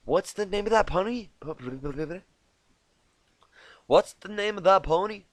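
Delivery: high, loud curious question; Applejack, curious. Acted. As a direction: puzzle over the name out loud. Applejack voiced, repeated line.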